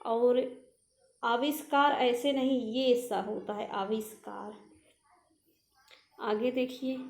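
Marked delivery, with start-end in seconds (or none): none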